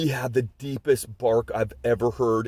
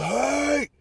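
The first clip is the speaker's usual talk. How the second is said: high-pitched mock holler, rising sharply; retold yell; 12 semitones higher than usual. Dog owner yells at his attacking Rottweiler, high retold yell. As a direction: imitate a yelled command without full volume. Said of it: "he yelled".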